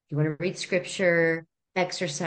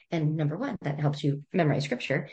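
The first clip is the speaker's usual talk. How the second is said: normal talking voice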